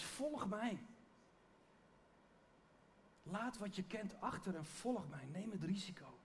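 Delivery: softly quoted invitation; quiet earnest call. Retold or imitated. Preacher quoting Jesus asking followers to take the risk, quiet and earnest. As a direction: drop well down in volume and invite them in earnest.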